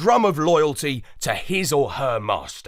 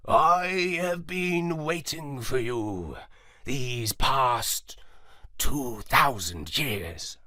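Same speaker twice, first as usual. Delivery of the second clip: lightly voiced old dog, slow and soft; a hint of an ancient dog, slow and measured; clear moondog voice; 4 dB quieter, much slower than usual. A narrator's reading. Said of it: "said clearly and without an accent".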